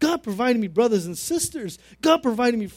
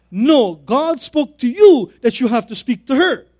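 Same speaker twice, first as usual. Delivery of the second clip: loud, slow, higher-pitched acted command; firm, forceful reply, drawn out and loud; emphatic insistence; 4 semitones higher, 11 dB louder than usual. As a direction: drive each phrase home with raised voice. Pastor repeats his order, more forcefully.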